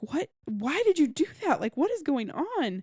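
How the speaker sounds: high-pitched retold bewildered demand; mock disbelief. Addie confronting the guys after their fight, in mock disbelief. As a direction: act out a mildly incredulous question.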